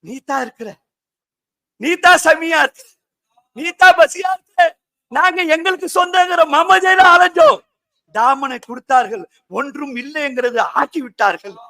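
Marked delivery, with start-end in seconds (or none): 1.8-7.6 raised, insistent sermon voice; fervent preaching. Real, not acted. Preacher declaring that God is manifest, fervently. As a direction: proclaim it to the whole gathering.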